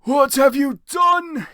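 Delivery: put-on high, loud, slow wailing old wizard; wise wailing. Dumbledore crying out at the band, high, loud and slow. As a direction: wail it out grandly. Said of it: "he started to cry wisely".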